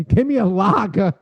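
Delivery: put-on Boston accent. Mocking a lager lover ordering, Boston accent. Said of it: "some people love 'em".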